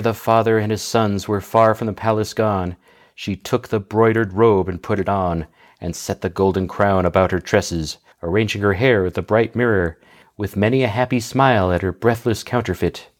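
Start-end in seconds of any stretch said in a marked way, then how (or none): none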